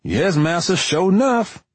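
high, put-on servile drawl; mock servile voice. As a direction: ham up a deferential servant. Narrator mocks servile reply, joke.

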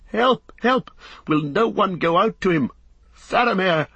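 desperate high shout; anguished, high-pitched call; crying for help. A narrator's reading. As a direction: shout for someone to save him, pitch soaring. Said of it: "cried Beregond".

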